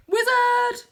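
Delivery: very high, loud shout; loud high triumphant cry; excited shout. Acted. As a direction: shout it with delight.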